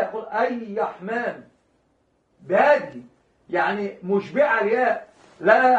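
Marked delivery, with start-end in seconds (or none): 0.2-1.4 "أي رحمن" said slowly with the ر swallowed into a long ي; drawn-out "أي رحمن", no ر sound at all; الراء تنطق ياء ممدودة; r dropped to y. Shown as a demonstration. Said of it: "يعني الراء لا يأتي بها".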